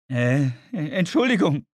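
leicht erhöhter Klageton; klagend. Acted. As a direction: melde dich klagend zu Wort. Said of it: "sagte Richard in klagendem Ton". (klagender Ton)

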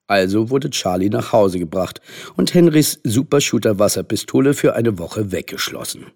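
ordinary delivery

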